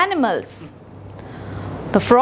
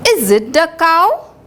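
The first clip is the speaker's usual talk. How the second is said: usual speaking voice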